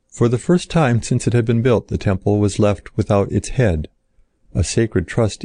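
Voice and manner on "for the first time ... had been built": ordinary delivery, nothing marked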